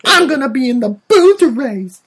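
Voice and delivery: high, loud little-boy impression; squeaky, belted child voice; Anakin. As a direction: yell it like an over-eager kid. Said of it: "And then Anakin".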